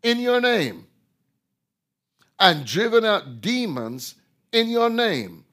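loud, high preaching climax; high, loud pulpit delivery; fiery preaching. Acted. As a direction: thunder the verses at them. Preaching at full force.

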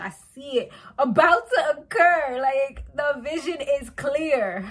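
lightly hammed-up fortune teller, slowing down; mock psychic. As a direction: play a seer announcing a vision. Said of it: "I could be a psychic right now".

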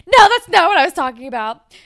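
loud, high, furious denial; angry outburst. Retold or imitated. Ellie, drunk on stage, angrily shouts at her mentor. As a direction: blurt it out in a drunk rage.

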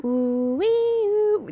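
high wavering ghost wail; spooky ghost noise. Shown as a demonstration. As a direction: make a spooky ooh sound. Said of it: "I'm making sound effects".

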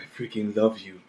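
low, soft seductive read. Retold or imitated. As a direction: breathe the caption out seductively. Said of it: "Why you said it in that seductive voice".

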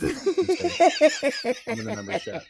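high, loud mocking voice with a laugh; mock smug voice. Mocking a viewer who feels safe, high and laughing. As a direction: taunt in a silly high voice.

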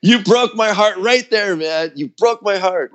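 high, slow hammed-up wounded voice; imitating a crushed friend, slowed and high; mock heartbroken. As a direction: play the hurt bandmate, over the top. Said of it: "And he goes".

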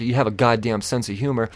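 usual speaking voice

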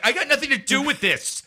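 imitating an angry editor brushing off blame; mock defensive protest. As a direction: deny it all, a touch outraged. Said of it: "he's like".

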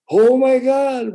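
slow, loud and high oh my God; hammed-up royal alarm; king's astonishment. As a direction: burst out in shock. King hears four thousand.

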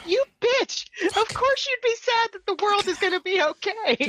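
high-pitched indignant accusation; comic acted indignation; mock outrage. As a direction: lash out in a high, hammed-up voice. Acting Dawn's outraged accusation of Buffy.

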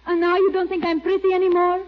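fast, coy, wounded pout. Acted. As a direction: fish for a compliment with a pout. Coy flirt fishing for compliments.

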